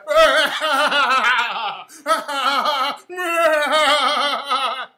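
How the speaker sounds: loud, long cackling laugh; demonstrated big laugh. Shown as a demonstration. Comic showing the crowd his gloating laugh, a loud cackle. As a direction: let rip a huge mocking cackle. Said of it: "with the coon laugh".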